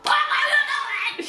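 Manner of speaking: very high, hammed-up angry squeal; exaggerated high-pitched scolding; mock mad. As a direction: imitate a furious friend, fast and shrieky. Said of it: "so mad at me for fighting".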